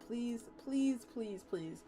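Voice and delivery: plain reading voice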